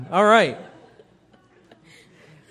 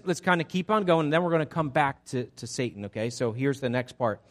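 ordinary delivery

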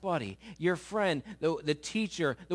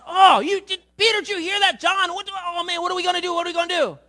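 much higher and louder, frantic; hammed-up alarmed cry; mock panic; 11 semitones higher, 10 dB louder, faster than usual. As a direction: disciples panicking to each other. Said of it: "they're, like, distressed".

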